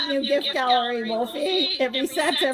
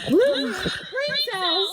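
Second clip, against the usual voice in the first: very slow giggle; laughing; 6 semitones higher, much slower than usual. Real, not acted. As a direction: drag it out laughing. Stoned laughing.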